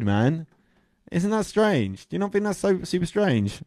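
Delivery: ordinary talk, nothing marked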